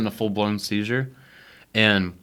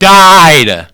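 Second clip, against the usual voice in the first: suddenly loud, blurting it out; loud outburst; 8 semitones higher, 16 dB louder than usual. Real, not acted. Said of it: "Sorry, that was probably loud".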